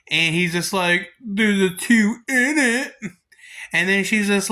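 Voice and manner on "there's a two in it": put-on sly kid excuse. [name] defends the pricey DVD.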